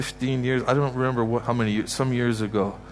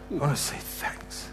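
hushed thanks; 4 semitones higher, 7 dB quieter than usual. Acted. Voicing the thankful crowd.

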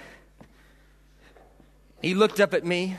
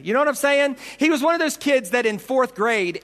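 ordinary delivery

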